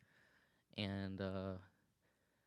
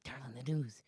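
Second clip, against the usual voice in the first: raised, nagging voice from the back of the head; light comedian impression, pitched up at first, easing off; Bill Burr. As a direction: do a nagging comic's voice. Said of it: "that's my Bill Burr voice".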